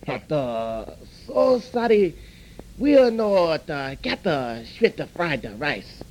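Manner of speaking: a broad, put-on Chinese accent from the restaurant man; mock Chinese accent. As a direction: play the chop suey house owner with a mock accent. Restaurant owner voiced by customer's teller.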